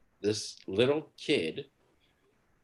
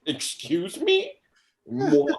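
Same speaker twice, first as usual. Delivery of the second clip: slow, high, loud mock affront; hammed-up shocked indignation; taken aback; 7 dB louder, much slower than usual. Dad stunned by his little son's insult, high affronted disbelief. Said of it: "so taken aback".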